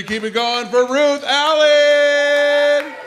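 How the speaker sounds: high, drawn-out name call; announcer intro. Real, not acted. MC brings Ruth Allen on stage, drawn-out announcer call. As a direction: stretch the name out to rouse the crowd.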